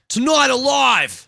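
announcer hype. Acted. Announcing the band.

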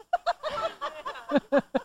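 light airy laugh fading out; high giggle. Real, not acted. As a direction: laugh at yourself. Laughs after own joke.

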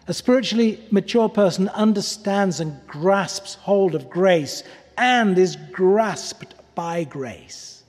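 normal talking voice

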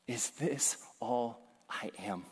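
quiet, slow ashamed question; lightly voiced despair. Woman waking in shame, asking herself softly and slowly. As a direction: ask it softly, weighed down. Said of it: "she says".

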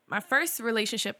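ordinary talk, nothing marked

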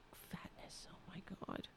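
unvoiced whisper to the cat; hushed teasing whisper at the fat cat. Real, not acted.

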